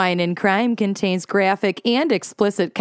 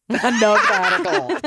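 laughing; 8 semitones higher than usual. Real, not acted. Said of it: "The accuracy, though".

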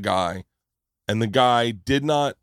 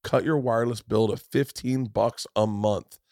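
upbeat, higher sponsor read, pushing the deal; 4 semitones higher, 4 dB louder than usual. Acted. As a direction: sell it like a radio spot, bright and eager. Host reads a sponsor ad.

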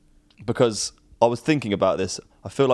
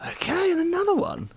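mock squeak. Quizmaster squeaks surprise.

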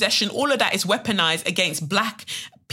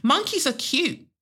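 much higher, airily dismissive quote; mock dismissiveness; 9 semitones higher, slower than usual. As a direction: play it as a friend brushing off offence. Voices dismissive friends in poem.

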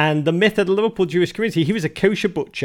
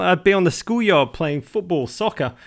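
ordinary talk, nothing marked

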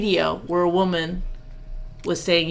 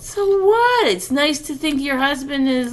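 high, defensive comeback; indignant retort; 7 semitones higher than usual. Real, not acted. Wife answers husband's remark about acting tough, indignantly. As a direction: throw it back at a sharply raised pitch.

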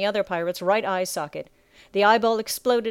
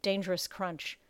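ordinary delivery, nothing marked

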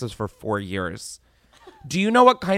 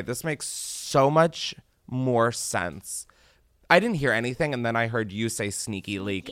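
ordinary talk, nothing marked

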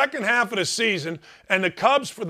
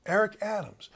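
toned down, softer voice; talking softer; 6 dB quieter than usual. Real, not acted. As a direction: dial the volume back on the name. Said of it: "I'm gonna talk softer".

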